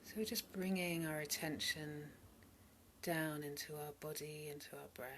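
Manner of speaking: calm meditation voice, spacious pauses between phrases; guided meditation. Real, not acted. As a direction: softly lead listeners into body and breath. Leads a meditation.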